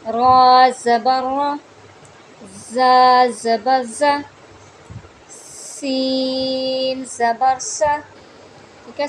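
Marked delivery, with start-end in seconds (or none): 0.1-8.0 very slow, loud spelling with a heavy रा and a whistled सा; spelling drill. Shown as a demonstration. Teacher spelling out Qaida syllables for students, slow and loud. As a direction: spell each syllable out carefully. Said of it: "सीन में सीटी बरकरार रखनी है".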